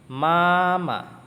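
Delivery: mama held high and loud; first tone mama. Shown as a demonstration. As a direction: sing mama on one high level note. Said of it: "Mama, nada satu".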